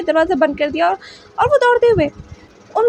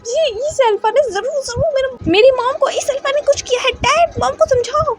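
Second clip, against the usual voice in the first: agitated accusation; 8 semitones higher than usual. Acted. Wing accuses the alpha, pleading to dad.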